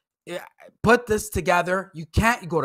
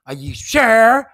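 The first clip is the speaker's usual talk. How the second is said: slow, loud incredulous are you sure; a mock customer refusing to believe the waitress; mock doubtful; 8 dB louder, slower than usual. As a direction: drag it out as if she must be wrong. Mocking Ken doubting waitress.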